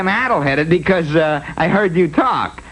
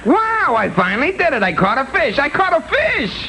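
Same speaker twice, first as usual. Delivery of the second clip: excited; 9 semitones higher than usual. Acted. Fisherman finally catches a fish.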